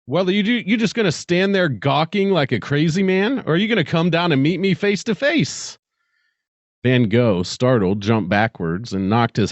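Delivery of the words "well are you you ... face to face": much higher, impatient stranger calling up from below; a strange visitor teasing him to come down; high, mocking alien caller. A narrator's reading. The figure spoke.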